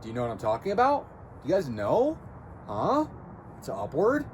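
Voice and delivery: questioning rise on "about", "know", "Huh" and "upward"; upward inflection. Shown as a demonstration. Coach trying out the habit, "upward" said with upward inflection. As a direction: end each phrase climbing, up on "upward". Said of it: "I can't even really do it that good".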